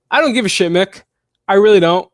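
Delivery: loud, high, fed-up dismissal; exasperated yelling at a fan question; emphatic outburst. Real, not acted. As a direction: blurt it out like you're done with the topic. Answering a fan's question, exasperated.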